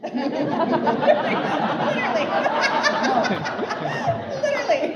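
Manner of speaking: real helpless high-pitched laughter through the words; cracking up. Real, not acted. Panelist topping her own joke to the audience, cracking up. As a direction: squeal it out while laughing hard.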